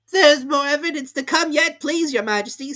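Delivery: high, loud eager rabbit voice; hurried rabbit. A narrator's reading. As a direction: rush in as the fussy rabbit. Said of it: "jumping up in a great hurry".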